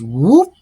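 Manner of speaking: high, loud siren whoop; imitated siren whoop. Police car behind him, high loud siren whoop.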